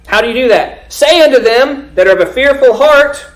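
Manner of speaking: high, loud scripture proclamation; forceful preaching. Acted. Preacher urging word to the fearful of heart, forceful preaching. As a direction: call it out from the pulpit.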